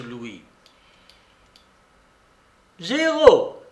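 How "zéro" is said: very high, loud call across the table; croupier's cry. A narrator's reading. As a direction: call the result out loudly. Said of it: "cria le croupier". (cri du croupier)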